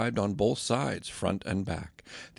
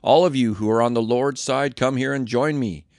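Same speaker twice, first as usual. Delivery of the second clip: loud, higher rallying call; shouted; 3 semitones higher, 7 dB louder than usual. A narrator's reading. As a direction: summon them from the camp gate. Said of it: "and shouted".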